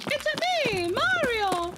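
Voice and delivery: high, cartoonish Italian plumber voice; Italian accent. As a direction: put on a thick Italian accent. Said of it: "doing an Italian accent".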